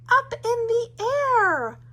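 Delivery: plain reading voice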